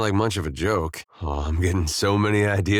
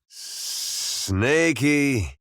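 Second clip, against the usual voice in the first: high, drawn-out coaxing; sing-song pet call; 4 semitones higher than usual. Acted. Man coaxing his snake, high sing-song call. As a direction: stretch out the pet name, cooing.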